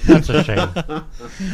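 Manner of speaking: stretched out slowly through laughter; very slow drawn out. Pretend. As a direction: drag every word out, laughing. Repeats it drawn out.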